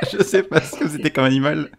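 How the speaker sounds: high giggling words; laughing. Real, not acted. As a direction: talk through giggles. Fou rire. (en riant)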